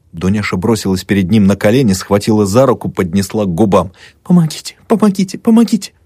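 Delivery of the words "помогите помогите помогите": rising higher with each plea; pleading. A narrator's reading. Dunyasha on her knees begging the doctor, rising desperate plea. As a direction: beg more desperately each time.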